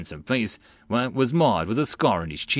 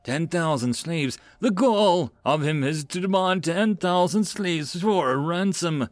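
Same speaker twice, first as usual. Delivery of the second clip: ranting; 6 semitones higher than usual. A narrator's reading. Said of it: "Titus continued to rant on".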